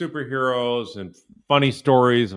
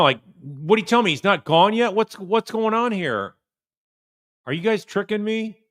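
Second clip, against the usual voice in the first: mocking the suspect; 10 semitones higher than usual. Commentator mocks the suspect's hidden panic, higher. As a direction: parrot her words back as a panicked, guilty suspect.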